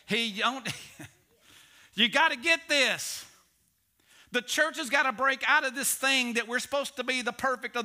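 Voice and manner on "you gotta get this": ordinary talk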